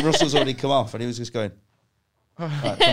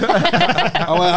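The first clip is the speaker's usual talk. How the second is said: ordinary talk, nothing marked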